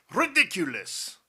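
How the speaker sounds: loud, high snort of contempt; snort, then dismiss it loudly; scornful snort. A narrator's reading. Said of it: "snorted the general".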